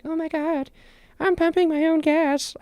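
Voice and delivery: high-pitched squeal of delight; squealing excitement. Retold or imitated. To herself at the pump, squealing with delight. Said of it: "I was like".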